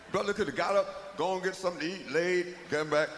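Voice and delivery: normal talking voice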